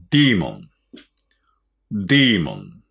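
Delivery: "demon" with a long "dee" first vowel; long "dee" sound. Shown as a demonstration. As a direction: say "demon" as dee-mon, not day-mon. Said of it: "発音はディーモンですね".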